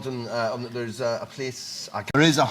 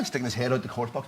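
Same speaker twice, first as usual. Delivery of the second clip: usual speaking voice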